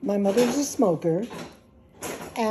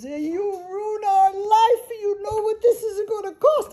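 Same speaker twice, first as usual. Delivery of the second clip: mother wailing about the cost; upset mother; 11 semitones higher than usual. Retold or imitated. Mother scolding after crash.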